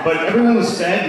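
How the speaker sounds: ordinary talk, nothing marked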